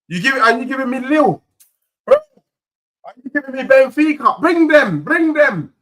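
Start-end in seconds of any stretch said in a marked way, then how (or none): none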